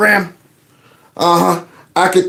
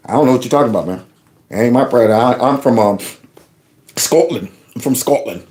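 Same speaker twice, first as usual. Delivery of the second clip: lower, badly faked Scottish accent; a would-be Scottish accent that slides toward Australian; failed Scottish accent; 7 semitones lower, faster than usual. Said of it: "That's not even a Scottish accent".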